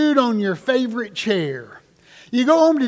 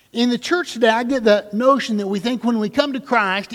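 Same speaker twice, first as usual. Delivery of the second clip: ordinary talk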